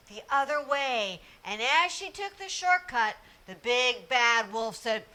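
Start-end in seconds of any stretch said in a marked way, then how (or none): none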